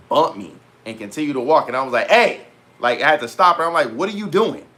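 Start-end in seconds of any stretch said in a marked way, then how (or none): 2.1-2.4 loud, high indignant "hey"; calling out. Retold or imitated. Retold calling out bumping girl. 3.9-4.6 higher-pitched, indignant challenge; retold confrontation.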